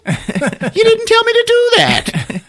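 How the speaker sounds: very high, slow squawked protest; hysterical protest. Retold or imitated. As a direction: drag it out in a comic shriek. Repeats protest, escalated.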